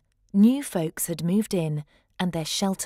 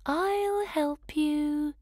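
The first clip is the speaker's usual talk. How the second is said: a lightly voiced sea unicorn, slow and kind; gentle call; 7 semitones higher, much slower than usual. A narrator's reading. Sea unicorn calling to the lost pup, gently. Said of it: "she called".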